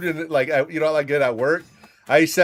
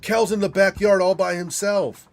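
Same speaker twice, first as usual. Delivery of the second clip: panicked call; 7 semitones higher than usual. Retold or imitated. Son calling mom in a panic about the dog, frantic. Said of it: "I call her freaking out".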